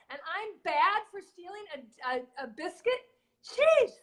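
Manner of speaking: a dog ranting at his owner, scandalized; indignant talking dog. Retold or imitated. Joke-telling host voices the dog scolding humans, outraged and high. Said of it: "the guy looks at his owner and he goes".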